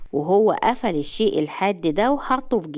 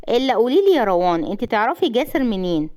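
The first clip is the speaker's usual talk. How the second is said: ordinary delivery, nothing marked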